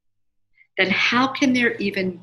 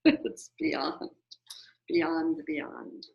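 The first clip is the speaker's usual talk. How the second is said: exasperated sigh; 4 semitones lower, 8 dB quieter, much slower than usual. Real, not acted. Overwhelmed by certification process.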